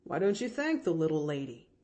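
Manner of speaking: cheerful driver cutting in; coachman Timothy. Acted. Coachman reassuring the girl, lightly voiced man.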